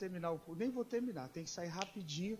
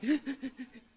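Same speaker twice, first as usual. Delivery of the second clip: imitated sobbing gasp; 5 semitones higher, 6 dB louder than usual. Imitates pastor crying on phone.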